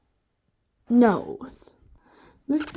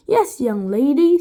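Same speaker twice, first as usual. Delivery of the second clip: villain voice; 8 dB louder than usual. A narrator's reading. Mr Gorf character voice.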